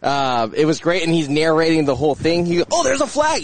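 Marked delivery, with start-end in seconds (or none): 2.7-3.4 high-pitched mock outburst at the TV; imitated scream. Co-host imitating colleague narrating the game, mock-screaming high. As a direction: imitate an excitable viewer spotting a penalty. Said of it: "He's screaming that every five minutes".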